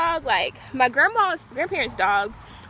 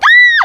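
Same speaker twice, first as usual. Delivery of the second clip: sudden shrill delighted shriek, much higher and louder; excited scream; 15 dB louder than usual. Retold or imitated. Girl spotting a wiener dog and shrieking to friends, excited scream. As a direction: squeal at the sight of a wiener dog. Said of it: "I would, like, scream".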